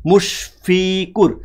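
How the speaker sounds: মুশফিকুর split slowly into syllables, ফি held; slow syllabified demo. Shown as a demonstration. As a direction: spell out মুশফিকুর slowly, one syllable at a time. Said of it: "বলছি ফি".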